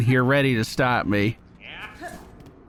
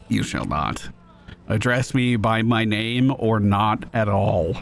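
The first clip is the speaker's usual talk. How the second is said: much lower, cold and curt; lowered stern voice refusing the flirt; stern cold warrior; 7 semitones lower, slower than usual. Acted. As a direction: shut him down flatly. Voices stern Hornet reply.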